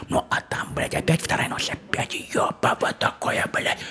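тихо, таинственным шёпотом; шепчет, как по секрету. Real, not acted. Рассказчик переходит на шёпот. (шёпот рассказчика о второй ночи)

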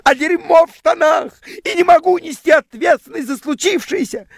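high, loud imitated scream. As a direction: shriek it like a hysterical girlfriend. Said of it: "Она кричала мне".

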